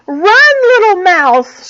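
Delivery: loud, high urgent shout. A narrator's reading. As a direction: yell a warning to run. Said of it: "shouted the squirrel".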